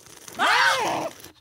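loud airy slurp through the lips; slurping wine tasting. Shown as a demonstration. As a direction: suck air loudly through wine. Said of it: "put a little bit in your mouth".

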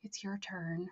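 soft, sweet murmured imitation; cutesy. Man handing over the prayer to the student, cutesy and soft. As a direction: imitate him softly, all cutesy. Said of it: "all cutesy and sweet".

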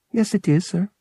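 high, mechanical droid reply; obedient droid. A narrator's reading. Droid confirms the word to pilot, obedient and mechanical. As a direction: answer promptly like a polite robot.

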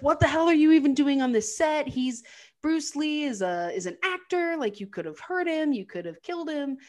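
a mock-furious wife tearing into him; hammed-up outraged scolding; livid wife. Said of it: "she is just so livid".

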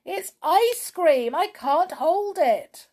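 high, alarmed exclamation; distressed high cry; cried out. A narrator's reading. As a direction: wail it in mounting panic, loud and drawn out. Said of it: "he cried".

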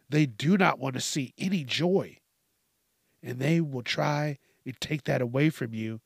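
ordinary talk, nothing marked

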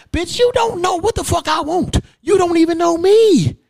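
high, loud shout; retold shout. Son shouting back at the preacher who discourages him, high and loud.

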